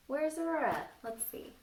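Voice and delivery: usual speaking voice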